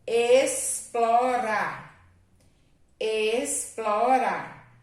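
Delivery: Explorar slow and loud, x said as s. Shown as a demonstration. Teacher slowly saying Explorar to show x sounds like s. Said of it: "tem som de S".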